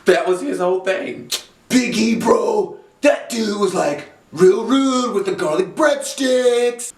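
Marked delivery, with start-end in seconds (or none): 1.7-6.9 slow, higher mock whine about the breadsticks; mock whiny complaint. Dolph whining to Big E about a rude guy, slow mock whine. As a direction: whine it like a spoiled wrestler.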